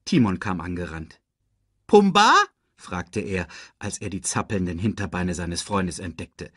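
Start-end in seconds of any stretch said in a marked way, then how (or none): 1.9-2.3 high, loud, rising call; puzzled little sidekick calling out. A narrator's reading. Timon searching for friend.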